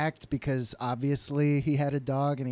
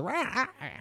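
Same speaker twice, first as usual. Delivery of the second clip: high yapping dog sound; dog noise; 9 semitones higher, 4 dB quieter than usual. Retold or imitated. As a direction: imitate a cartoon dog's noise. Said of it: "makes a weird noise".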